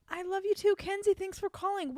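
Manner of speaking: high, gushing thank-you; beaming with joy; delighted. Real, not acted. Host answers caller's loving goodbye.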